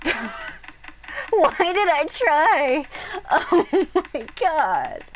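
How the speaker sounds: high, loud, stretched-out laughing groan; laughing despair. Real, not acted. Streamer laughing at herself after the name struggle, high and loud. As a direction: laugh in disbelief at your own effort.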